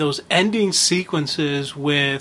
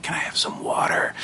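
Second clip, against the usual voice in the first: whispered creepy villain line; hushed, whispery Joker impression; 5 dB quieter than usual. Speaker retells the Joker's potato chip scene, creepy whisper. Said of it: "that creepiness to it".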